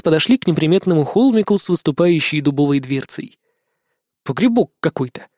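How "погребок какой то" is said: scornful, dismissive remark; contemptuous snort. A narrator's reading. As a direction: sniff at it with contempt. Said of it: "презрительно фыркнул Никитка".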